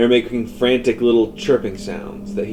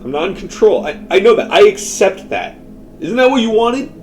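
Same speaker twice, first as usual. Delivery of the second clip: higher, louder, furious and desperate; angry outburst; 10 semitones higher, 4 dB louder than usual. Acted. Man alone in car shouting at the voice, furious. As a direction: yell it at the silence, losing control. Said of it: "the silence that follows pisses him off".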